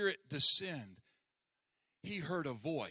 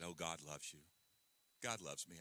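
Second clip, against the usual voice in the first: offhand rote phrase; 13 semitones lower, 15 dB quieter than usual. Shown as a demonstration. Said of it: "it rolls off our tongue".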